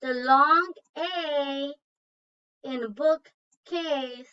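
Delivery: stretched out slowly, stressing long A; slow and careful. Shown as a demonstration. Teacher slowly pointing out the long A in bookcase. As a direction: draw it out slowly for the class. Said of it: "locate words with a long A sound".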